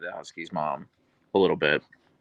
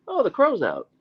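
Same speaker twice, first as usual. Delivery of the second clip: delighted surprise; 14 semitones higher than usual. Real, not acted. Spots crows outside.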